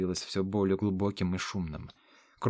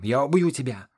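higher, rising angry cry; furious threat; 4 semitones higher, 4 dB louder than usual. A narrator's reading. Hero threatening the dark knight below, furious threat. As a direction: throw the threat down at the enemy.

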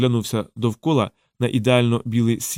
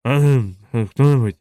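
louder, grumbling sleeper's call; sleepy complaint; 6 dB louder, slower than usual. A narrator's reading. Sleeper complaining through an open window, grumbling. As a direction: grumble out loud from half-sleep. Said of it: "скаржився Сплюх".

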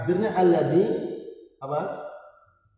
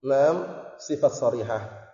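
slow, soft model reply; slow, soft model answer for students; 3 semitones lower, 6 dB quieter, slower than usual. Shown as a demonstration. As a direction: say the answer slowly for the class to copy. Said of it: "Ngomongnya gitu, ngomongnya".

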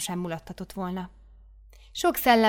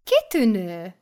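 louder exclaimed praise; mother superior praising; 7 dB louder, slower than usual. Acted. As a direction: cry out your approval. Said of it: "mondta a főnöknő".

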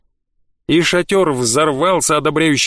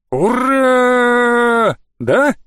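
cheering shout; 8 semitones higher, much slower than usual. Acted. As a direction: cheer with the crowd. Crowd cheering hurray.

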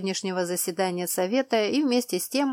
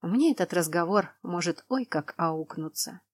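plain reading voice

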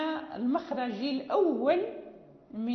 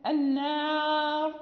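slow, drawn-out recited word; Quran recitation; much slower than usual. Shown as a demonstration. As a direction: recite the word slowly as in tajweed. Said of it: "مثلًا".

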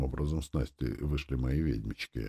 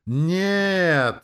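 very high, long-held full yell; louder crowd yell; 14 semitones higher, 8 dB louder than usual. A narrator's reading. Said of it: "заорали громче". (протяжный громкий ор)

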